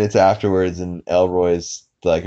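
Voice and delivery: ordinary talk, nothing marked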